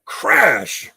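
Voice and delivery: loud, drawn-out crash falling sharply in pitch; sudden crash. Retold or imitated. Man retelling a noise that woke him in bed, loud imitated crash. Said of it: "all of a sudden I hear this".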